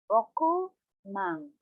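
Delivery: rokumang said slowly, ro-ku-mang; slow careful model. Shown as a demonstration. Teacher modelling for the class, slowly. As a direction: rokumang spaced out syllable by syllable. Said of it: "sixty thousand, rokumang".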